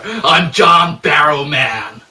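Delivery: slow, shouted macho "I'm John Barrowman"; hammed-up macho self-introduction; manly John Barrowman. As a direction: boom it out like a manly hero. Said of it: "Emphasis on the man".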